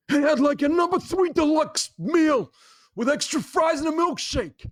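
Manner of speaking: loud, high, pushy American accent; hammed-up angry Northeast American accent. Shown as a demonstration. Podcast guest showing the host his best American accent, loud and angry. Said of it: "give us your best American accent".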